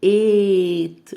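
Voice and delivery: ايت said slowly and firmly; stressed slow demo. Shown as a demonstration. Teacher stressing the past tense word for students. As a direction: lean on ايت and drag it out. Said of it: "الماضي بتاعها ايت".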